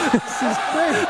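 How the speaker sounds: high, laughing through the words; cracking up at the video. Real, not acted.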